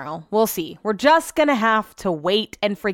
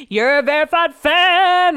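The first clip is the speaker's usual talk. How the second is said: higher, louder, drawn-out mock announcement; triumphant announcement; 7 semitones higher, 5 dB louder, slower than usual. Quoting the email she finally got, triumphant announcement. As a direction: stretch it out like a prize reveal. Quotes the long-awaited email.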